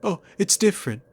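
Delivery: high, fast, loud mock whine; sarcastic mimicry; mocking impression. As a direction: squeak back the hero's excuse in ridicule. Mimics hero's defensive reply.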